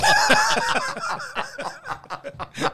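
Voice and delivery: high burst of laughter; big laugh. Real, not acted. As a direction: crack up hard. Laughing at the memory.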